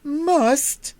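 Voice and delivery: high, loud outraged shriek of one word; screamed. A narrator's reading. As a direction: fling the word back in fury. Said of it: "screamed the princess".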